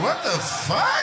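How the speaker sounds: loud slowed-down what-the; stunned outburst. Retold or imitated. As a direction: drag it out in shock. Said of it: "I was like".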